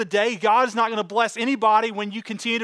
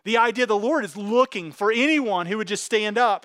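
ordinary talk, nothing marked

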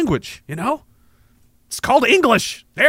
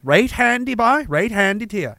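higher, put-on Newfoundland accent; lilting attempt at a Newfoundland accent; 3 semitones higher than usual. Shown as a demonstration. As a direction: pipe up like a Newfoundlander. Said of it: "I know it's in there".

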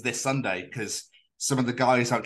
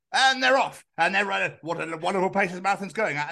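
high, bright commentator patter; mock commentator; 7 semitones higher than usual. Podcaster imitating a local marathon commentator, cheerful. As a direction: sell the race start. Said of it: "people are just like".

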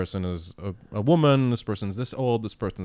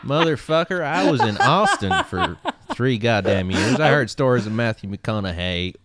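Matthew McConaughey impression; 5 dB louder than usual. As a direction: lean into a laid-back Texan ramble. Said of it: "Do I have a Matthew McConaughey".

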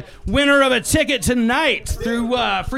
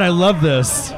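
usual speaking voice

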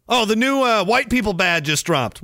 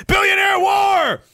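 high, loud, drawn-out cry; shout; 12 semitones higher, 4 dB louder, slower than usual. Real, not acted. Streamer proclaims a billionaire war with a loud high shout. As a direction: announce it like a battle cry. Excited exclamation.

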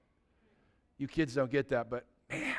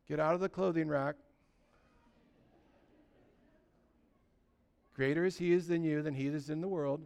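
soft reassurance; 3 semitones lower, 9 dB quieter than usual. Pretend. Jesus voiced soothing the believer.

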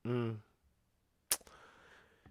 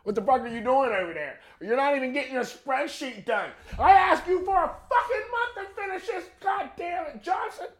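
much higher, yelling irate boss voice; angry boss. Retold or imitated. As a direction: rant at the worker like a furious boss. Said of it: "their boss went".

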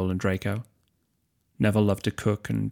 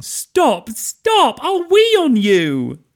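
very high, slowing squeal; giggling protest; 9 dB louder, much slower than usual. Acted. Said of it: "kept giggling and saying".

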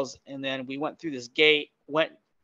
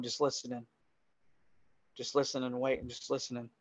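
very soft, lower storytelling; hushed suspense; 4 semitones lower, 14 dB quieter, faster than usual. Real, not acted. Storyteller lying prone in his tent listening, hushed and suspenseful. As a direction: let it sink to near silence.

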